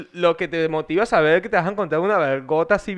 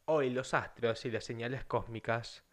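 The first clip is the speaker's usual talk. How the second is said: Alfonso León impression; 7 semitones lower, 15 dB quieter than usual. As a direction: imitate a late-night seer intoning the stars. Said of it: "Alfonso León".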